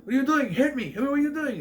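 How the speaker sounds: high, loud imitation of a frantic man; acted shouting. Man shouts at someone just standing there, imitated frantic yelling. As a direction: yell in disbelief, playing a film character.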